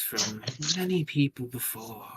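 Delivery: put-on voice of an indulgent lord; young lord. Young lord explaining foul words to Aaron, put-on lordly voice. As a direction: speak as the composed young lord.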